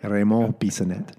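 name said with a French accent; French pronunciation. Shown as a demonstration. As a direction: say your own name the French way. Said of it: "Say it again?".

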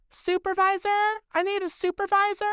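shrill high yell for a supervisor; imitated screaming. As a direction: squeal it like a clerk calling for backup. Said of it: "starts screaming".

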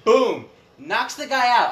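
loud burst of excitement; excited. Retold or imitated. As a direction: punch out the knockout. Retelling the knockout kick.

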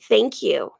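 "Thank you" dropped low and dry after the big one; low, deadpan "Thank you"; dry repeated thanks. Acted.

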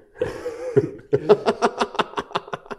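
high-pitched burst of laughter; long, high laugh. Real, not acted. Griner af kommentar om skriften. (højt, lyst grin)

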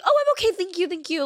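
high, sing-song fake politeness; squeaky overly sweet no thanks; mock polite refusal.

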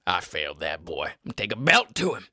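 very low, put-on father's threat; stern dad impression. Dad threatens his failed son, mock stern and low. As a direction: drop low like a menacing dad.

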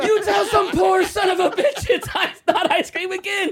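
high-pitched comic shouting; hammed-up boss losing it at staff; frantic mock rage; irate boss. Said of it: "your boss, he's grabbing you".

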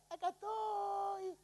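put-on small high childlike voice, slow; childlike answer. Adam answering God like a small child, high and slow. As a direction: answer shyly like a little child found hiding.

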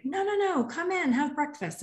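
high-pitched French cafe host, lightly imitated; lightly French-accented, insistent invitation. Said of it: "they had the French accent".

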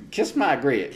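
a hint of a sassy diner waitress. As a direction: toss off the catchphrase like a waitress. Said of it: "what's a".

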